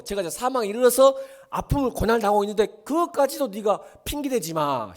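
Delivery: 높고 크게 몰아치는 설교; 목소리를 높여 강하게 다그치듯. Real, not acted. Preacher driving a point home. (목청 높여 훈계하는 설교)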